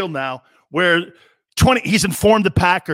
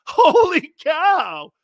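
laughing; 9 semitones higher, much slower than usual. Real, not acted. Host bursts out laughing on air.

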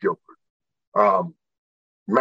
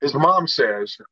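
ordinary delivery

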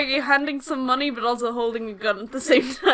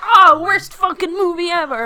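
high, loud whiny complaint; exaggerated disappointed-fan rant; mock outrage; 7 semitones higher, 9 dB louder than usual. Fan imagines hating the movie, loud mock-outraged cry.

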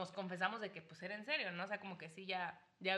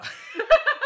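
loud high laugh; 16 dB louder than usual. Real, not acted. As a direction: burst of shrieking laughter. Loud laugh after joke.

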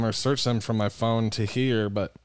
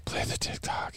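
unvoiced mocking whisper; 9 dB quieter, slower than usual. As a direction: whisper it back teasingly. Mock whispers the demand.

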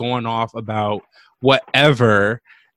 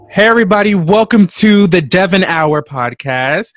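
loud, raised host greeting, slowing on the welcome; podcast voice; 4 semitones higher, 5 dB louder than usual. Real, not acted. Host welcomes listeners to the Devon Hour, podcast voice. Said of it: "get my podcast voice together".

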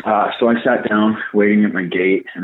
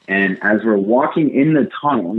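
usual speaking voice

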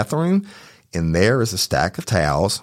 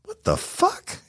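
whispered what the fuck, pitched high; high, hushed incredulous whisper; disbelieving whisper; 5 dB quieter, slower than usual. Retold or imitated. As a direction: breathe it out in horrified shock. Finds the hair, disbelief.

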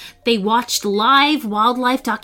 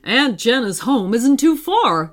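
lightly put-on father's voice; dad's voice. A narrator's reading. Dad talks to the children from the front seat, reassuring father voice. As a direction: reassure the kids in a fatherly character voice. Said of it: "Her dad looked at the children".

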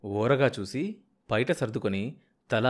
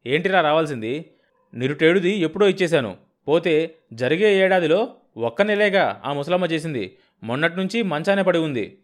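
angry argument; 6 semitones higher, 6 dB louder than usual. Acted. Heated quarrel over dues.

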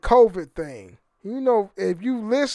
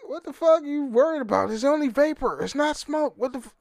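higher, fast mock exasperation at vaping worries; imitating Greg Gutfeld; 7 semitones higher than usual. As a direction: lightly play the pundit, scoffing fast and higher. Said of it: "And he was like".

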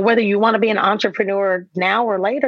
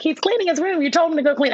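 much higher, lightly voiced kids; kids answering; 8 semitones higher, faster than usual. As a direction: answer like a matter-of-fact child. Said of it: "they're like".

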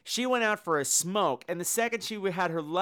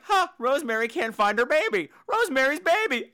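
delighted realization; 8 semitones higher, 4 dB louder than usual. Retold or imitated. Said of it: "so I'm like".

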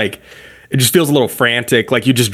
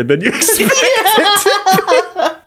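laughing; much slower than usual. Real, not acted. Laughing while explaining plug timing.

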